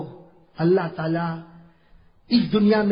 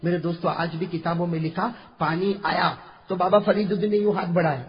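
usual speaking voice

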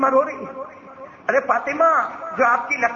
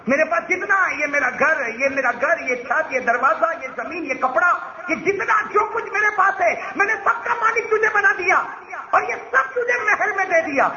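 high, impassioned list building higher; angry insistence; 8 semitones higher than usual. A narrator's reading. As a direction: pile up the offers, voice climbing. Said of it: "اللہ کے رسول بھی غصے میں". (غصے بھری بلند، پرجوش آواز)

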